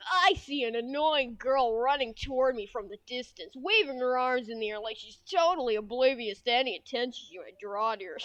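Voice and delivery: lightly voiced game protagonist; Scrimp. Streamer narrates as the mascot seeing a girl approach, lightly put-on character voice. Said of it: "This is Scrimp's voice now".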